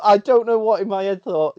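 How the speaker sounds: ordinary talk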